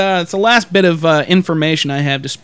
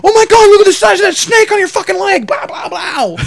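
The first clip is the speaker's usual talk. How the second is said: high, loud hammed-up terror at a snake; mock panicked shout; 13 semitones higher, 4 dB louder than usual. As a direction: shriek like a dreaming sleepwalker. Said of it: "then he's just going".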